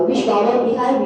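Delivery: ordinary talk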